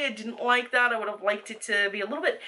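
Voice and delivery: ordinary delivery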